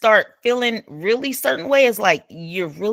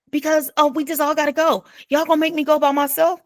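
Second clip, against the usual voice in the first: higher, whiny imitation of her insisting; pushy mock whine; 8 semitones higher, faster than usual. Imitating the woman who insists everyone has to go, a higher whine. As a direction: mimic her begging everyone to come along.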